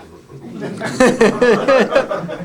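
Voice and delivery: loud burst of laughter; hearty laugh. Real, not acted. Coach laughing at players' panic, loud hearty laugh. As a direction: let a big laugh roll out. Laughs at his own story.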